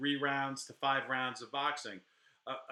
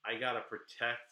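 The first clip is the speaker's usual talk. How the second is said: ordinary delivery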